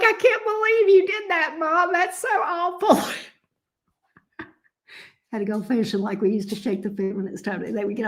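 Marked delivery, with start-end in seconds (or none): 0.0-3.0 higher, mock-whiny horrified teen; imitating daughter's dismay. Mother imitating her daughter's horror at the song, high and whiny. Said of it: "She's like".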